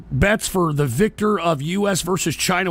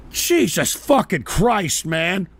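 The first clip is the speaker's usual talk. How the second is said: ordinary talk, nothing marked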